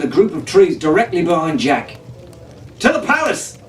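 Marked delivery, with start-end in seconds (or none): 2.8-3.6 high, ringing command; commanding shout. A narrator's reading. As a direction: order everyone to the palace. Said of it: "he commanded".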